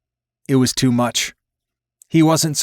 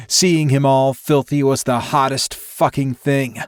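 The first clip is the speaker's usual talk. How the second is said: ordinary delivery, nothing marked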